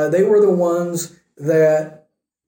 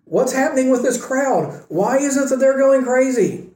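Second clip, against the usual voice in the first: higher, bewildered questions, lightly acted; confused disciples; 8 semitones higher than usual. Disciples asking about the wild crowd, mock bewildered. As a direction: puzzle aloud like a baffled follower. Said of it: "the disciples are saying".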